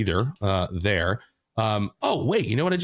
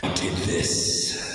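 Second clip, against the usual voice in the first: laughing whisper; 5 semitones lower, much slower than usual. Pretend. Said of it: "just be like".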